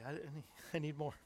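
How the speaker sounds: usual speaking voice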